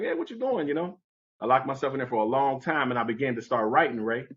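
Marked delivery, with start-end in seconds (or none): 0.0-0.7 high, called-out question from the other room; calling out. Retold or imitated. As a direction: call up to the locked bedroom door. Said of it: "My foster parents was like".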